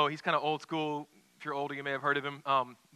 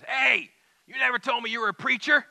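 much higher, louder astonished outburst; Harry, amazed; 9 semitones higher, 9 dB louder than usual. Retold or imitated. Diner owner to Campolo, stunned he's a preacher: loud high exclamation. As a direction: blurt it out in sudden amazement.

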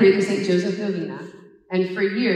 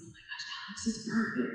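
awed whisper; 3 semitones higher, 13 dB quieter than usual. Retold or imitated. Awed at the ring.

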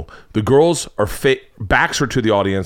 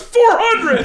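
much higher, laughing bid shout; excited kid bid; 4 dB louder than usual. Retold or imitated. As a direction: blurt out the bid.

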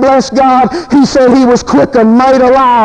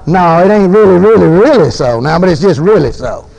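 mock caller; 9 semitones lower than usual. Voicing woman's reply.

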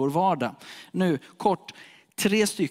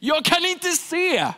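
beggar's plea; 12 semitones higher, slower than usual. Acted. Beggar's line, acted.

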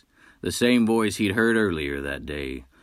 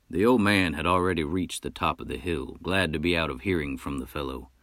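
usual speaking voice

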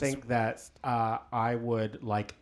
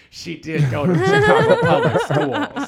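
much higher, louder squealed line through laughter; mocking laugh; 10 dB louder than usual. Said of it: "I'm always like".

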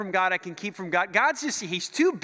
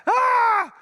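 sudden scream, much higher and louder; mock panic scream far up in the voice; panicked scream; 10 dB louder than usual. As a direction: shriek as if you just saw the God of Sinai. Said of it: "They're like".

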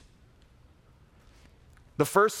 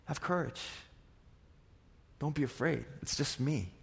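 much softer, gentle comfort; reassuring; 9 dB quieter than usual. Acted. Jesus gently reassures his terrified disciples, much softer. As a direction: soothe frightened friends.

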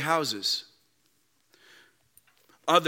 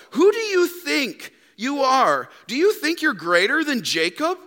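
indignant woman; 8 semitones higher than usual. Acted. Woman challenging Jesus over Jacob, indignant. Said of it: "She says".

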